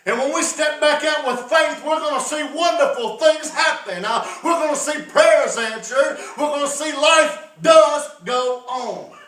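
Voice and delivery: loud, high, shouted sermon; preaching at full force. Acted. Preacher exhorting the congregation to step out in faith, shouting. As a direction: drive it home like a revival preacher.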